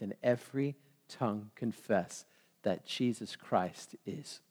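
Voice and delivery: low, soft, measured reverent recital; hushed scripture recitation. Acted. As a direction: let each phrase of scripture settle quietly.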